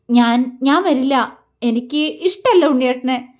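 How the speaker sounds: forcing the refusal out through a lump in the throat; choked voice. A narrator's reading. Malu refuses Vinod's invitation, voice choking. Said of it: "അവൾ ഇടർച്ചയോടെ പറഞ്ഞു".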